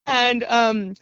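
ordinary talk, nothing marked

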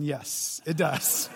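a hint of a solemn divine reply; voice of God. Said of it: "God is saying".

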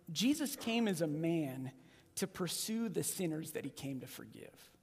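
low, soft and earnest, slowing down; sincere and soft. Real, not acted. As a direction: tell the heart of it gently. Sincere answer after the question.

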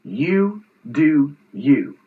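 slow, spaced-out dismissal, quoting her; retold brush-off, each word drawn out; dismissive brush-off. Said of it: "the final piece of this was she said".

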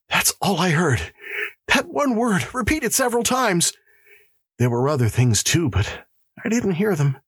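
high, distressed voice in halting phrases; frightened prisoner. Acted. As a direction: explain in high-pitched panic. Terrified prisoner answers the warden.

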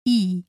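a pure ee vowel, short and clean; Spanish i sound. Shown as a demonstration. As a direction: hold a crisp ee. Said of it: "With an E".

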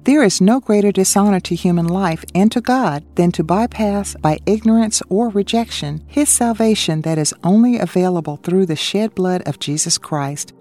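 ordinary talk

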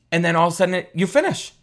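climbing up in pitch to a mock climax; hammed-up breathless peak; excited build. Climax of the VR story.

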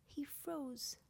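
usual speaking voice

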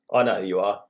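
a cold, curt quote of a rival; unfriendly. Retold or imitated. Co-host mimics the quote as sounding hostile. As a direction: make it sound a bit hostile. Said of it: "It didn't sound very friendly".

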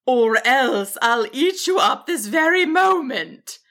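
threatening dragon. A narrator's reading. Dragon warns the stubborn wizard, threatening. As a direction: swoop through each word as a menacing dragon.

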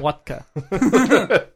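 laughing, high mock Russian "Vodka"; teasing a guest with a mock Russian word. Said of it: "You can't say vodka".

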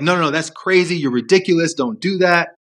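high, scoffing brush-off; dismissive inner voices. Retold or imitated. Competing inner voices shutting down your heart voice, high and scoffing. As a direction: wave the idea away like it's nonsense. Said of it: "all the other voices are, like, jumping in".

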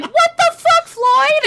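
high mock yell at her husband; mock shocked yell. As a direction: imitate mom shrieking at Floyd over the spill. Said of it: "And my mom's like".

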